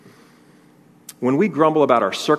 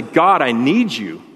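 slow, emphatic acted plea; drawn-out imitation of a plea; crying out; 4 dB louder, slower than usual. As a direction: cry out to God in need. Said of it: "doesn't cry out and say".

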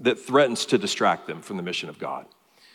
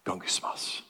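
whispered grumbling, repeated under the breath; hushed, discontented muttering that imitates the word's sound; low murmuring; 5 semitones lower, 13 dB quieter than usual. Shown as a demonstration. Preacher imitates the Greek word for complaint, whispered murmuring. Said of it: "sounds like what it is".

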